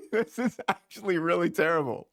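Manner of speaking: laughing through the words; real laughter breaking into speech. Real, not acted. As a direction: crack up while giving the verdict. Laughing at the bad accent.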